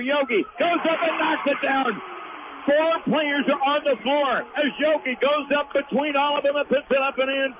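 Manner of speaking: announcer getting swept up in a wild scramble; excited play-by-play. Real, not acted. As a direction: ride the chaos under the basket, pitch climbing. Frantic rebound scramble.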